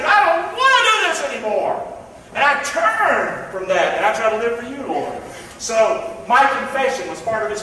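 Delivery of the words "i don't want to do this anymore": loud, high-pitched vow of resolve; emphatic declaration, pitched way up; attitude. Acted. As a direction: say it like you truly mean it. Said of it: "say it with an attitude".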